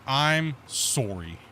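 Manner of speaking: drawn-out, deliberate sorry; exaggeratedly slow retold apology; mock formal apology. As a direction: stretch out the apology very slowly, pointedly. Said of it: "I'm gonna give you my formal apology".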